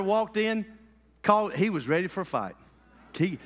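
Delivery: ordinary delivery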